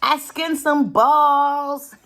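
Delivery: loud, slow, drawn-out "balls"; triumphant announcement. Real, not acted. Creator boasting to viewers about skinned balls, loud and jokey. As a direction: belt it out as a silly boast.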